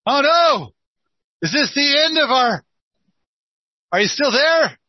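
sudden alarm at a dropped call. Real, not acted. Call drops mid-conversation.

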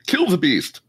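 angry mob leader. Acted. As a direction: shout it like a warband chief. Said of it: "One of them says".

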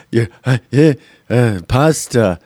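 lightly mocking politician impression; halting, fumbling mock delivery; Joe Biden. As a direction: poke fun with a hesitant put-on voice. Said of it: "between Joe Biden".

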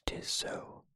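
plain reading voice

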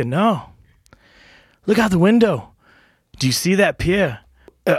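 hint of an Irish accent, rising in pitch with each grievance. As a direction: tell it as an old Irish barman in a mild Irish lilt. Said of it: "try my best at an Irish accent".

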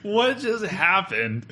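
high, slow mock bewilderment; retold disbelief. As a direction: drag it out high, acting dumbfounded. Retelling stunned reaction to bonus.

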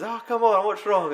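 high, loud feigned innocence; Woody feigning innocence loudly; innocent Woody. Retold or imitated. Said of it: "And he's".

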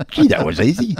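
high, loud and casually amazed; astronaut quote. Retold or imitated. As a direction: toss it off like it was nothing. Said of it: "Because he said".

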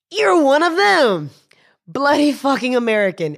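a hammed-up sneer of a furious drunk; angry drunk. Drunk man confronting her.

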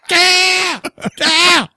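very high, drawn-out mock cry; long goofy high-pitched wail; silly high yelp. Clowning, high yelled noises.

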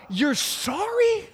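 high, incredulous you're sorry; outraged at a weak apology; mock-offended inner rant; indignant disbelief. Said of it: "And I thought".

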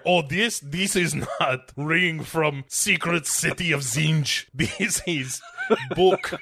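put-on halting, thick Eastern European-style accent; broken English in a hammed-up foreign accent, slow and deliberate; mock foreign accent. Book owner deflecting a nosy question, hammed-up foreign accent.

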